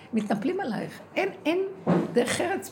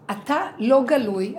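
louder, slow protest; a mild call of frustration; 2 semitones higher, 7 dB louder, slower than usual. Retold or imitated. Woman calling out to the hidden figure, slow and louder.

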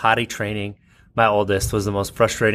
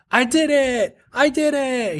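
put-on high, proud little kid shout; be a toddler crowing over his win; toddler yelling. Two-year-old proudly yelling in the bathroom, high toddler shout. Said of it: "He starts yelling".

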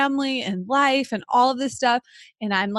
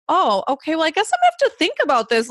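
higher-pitched re-enacted fluster; overwhelmed; 6 semitones higher, 4 dB louder, faster than usual. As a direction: act out reeling from the news. Retells being overwhelmed by the news.

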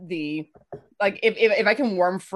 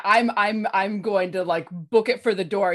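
ordinary talk

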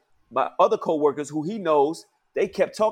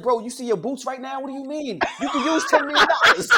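incredulous laughing shout; 7 semitones higher than usual. Real, not acted. Incredulous rant at coworker.

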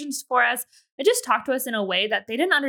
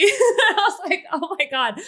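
laughing in disbelief, slow; shocked laughter; 8 semitones higher, 4 dB louder, slower than usual. Real, not acted. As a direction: laugh through the embarrassment. Retelling embarrassing moment.

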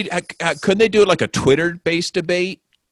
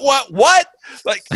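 incredulous; slower than usual. Real, not acted. Friend mocking a patriotic ad, high incredulous laugh. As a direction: squeak out the disbelief then crack up laughing.

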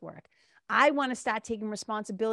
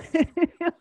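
loud laugh; 7 semitones higher, 8 dB louder than usual. Real, not acted. Joking with husband, bursts out laughing.

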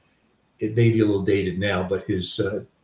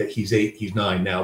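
ordinary talk

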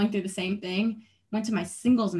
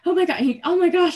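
high, loud panicked outburst; retold panic, high and loud; freaking out; 10 semitones higher, 7 dB louder than usual. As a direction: re-enact a panicked exclamation, pitched right up. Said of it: "I was freaking out".

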